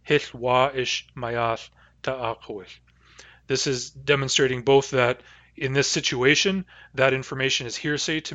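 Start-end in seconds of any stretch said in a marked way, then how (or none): 0.0-2.8 Hith wa ish mayath ta'akowith, said slowly with a long wa ish; Nuu-chah-nulth phrase. Shown as a demonstration. Teacher reporting the news to Lindsay as Hith wa ish mayath ta'akowith. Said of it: "the vowel of wa ish is long".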